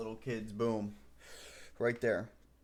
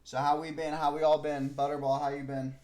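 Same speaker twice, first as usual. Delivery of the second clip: louder, cheerful greeting; 4 semitones higher, 7 dB louder than usual. Real, not acted. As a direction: call out to everyone. Loud greeting.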